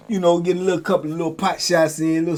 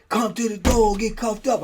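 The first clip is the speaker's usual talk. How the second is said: higher, barking guard command; guard's order; 7 semitones higher than usual. Retold or imitated. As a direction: order him to the door like a guard. Guards ordering him again.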